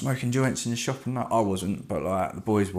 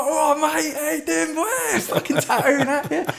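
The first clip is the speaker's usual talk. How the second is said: very high-pitched mock shop greeting; hammed-up greeting. Tattooist hailing people coming in to the shop, hammed-up high voice. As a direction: greet them in a silly, sky-high voice. Acted greeting to walk-in customers.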